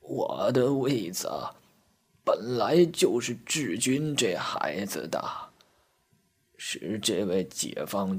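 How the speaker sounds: put-on very slow, soft elderly voice; hushed, halting old lady; toothless old woman. A narrator's reading. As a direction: draw out every word like a frail granny. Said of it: "瘪嘴老太婆站了起来".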